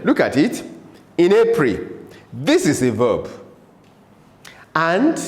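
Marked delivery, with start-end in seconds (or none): none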